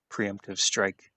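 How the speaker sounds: ordinary talk, nothing marked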